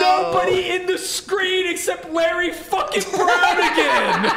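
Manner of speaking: high, laughing shout at the screen; exasperated outburst. Real, not acted. Fan yelling at the highlight of the interception, high and laughing. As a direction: yell in disbelief at the replay. Reacting to bad highlight replay.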